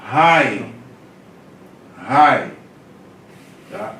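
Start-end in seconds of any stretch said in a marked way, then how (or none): 0.0-2.5 rai with an aspirated r-like onset, said loud; rai, louder, like a teacher's model; rough breathing demo. Shown as a demonstration. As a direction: say rai with a strong breathy start. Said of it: "e é lido como".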